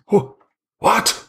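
high, panicked gasp of confusion; a shaken man caught off guard; startled shock. Acted. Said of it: "he just looks at you and goes".

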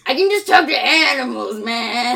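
loud, laughing comic whine; Eliza. Acted. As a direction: protest loudly, half laughing. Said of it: "And Eliza's like".

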